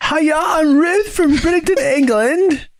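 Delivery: shrieky, very high fake British voice; exaggerated British accent. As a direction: ham up a silly high-pitched British accent. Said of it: "love doing British accents".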